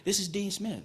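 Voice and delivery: a high, light Southern drawl. Retold or imitated. College coach calls a teenage recruit, in a Southern drawl. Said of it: "in his little southern drawl".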